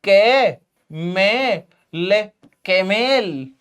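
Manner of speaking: केमेल with long 'e' in both syllables; drawn-out 'ay' vowels in केमेल; kamal turned kemel. Shown as a demonstration. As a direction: spell out के, मे, ले, then join as केमेल. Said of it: "फिर तो कमल ऐसा बनेगा".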